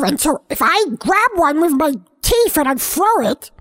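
ordinary talk, nothing marked

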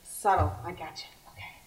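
ordinary talk

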